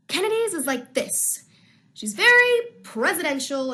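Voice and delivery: a hint of a bold, presidential girl; Kennedy voice. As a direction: lightly put on the confident Kennedy voice. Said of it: "I also have a Kennedy voice".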